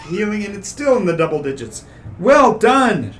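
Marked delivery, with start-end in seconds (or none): 2.2-3.1 P=A; excited praise. Real, not acted. GM praises player loudly.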